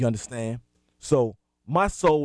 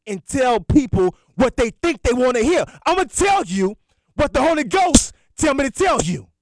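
much higher and louder, fiery preacher; full-force preaching; 10 semitones higher, 7 dB louder than usual. Acted. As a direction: preach it hard to the lost.

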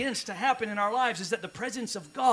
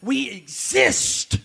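very slow, louder, higher; drawn-out climax; 4 semitones higher, 6 dB louder, much slower than usual. Acted. Pastor proclaiming the church's purpose, stretched slow. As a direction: stretch every word out.

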